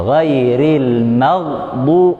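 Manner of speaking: very slow "Ghairil maghdu-" with a throaty gha; slow recitation. Shown as a demonstration. Teacher recites slowly, showing the b goes straight in. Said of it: "langsung masuk".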